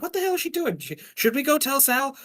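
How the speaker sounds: put-on high, worried young lackey voice; nervous gang kid. Gang boys worrying whether to tell Sal, high nervous voice. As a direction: fret about it like a jittery underling. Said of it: "the other boys being like".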